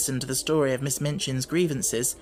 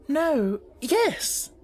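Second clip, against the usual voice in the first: much higher, confused no then yes; flustered answer; 12 semitones higher than usual. Acted. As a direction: fumble for the answer. Flustered sister.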